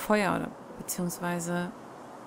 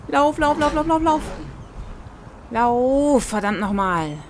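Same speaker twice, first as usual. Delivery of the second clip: high, loud panicked yelling; frantic cries while fleeing; being chased; 6 semitones higher, 5 dB louder than usual. Real, not acted.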